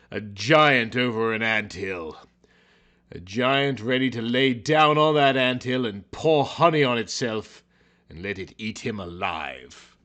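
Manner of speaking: ordinary delivery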